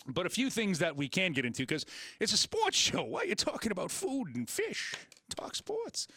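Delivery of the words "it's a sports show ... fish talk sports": lightly voiced high-pitched complaining caller; mock annoyed listener. Host voicing a fan who wants sports talk, mock high whine. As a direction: squeak it out like a fed-up fan.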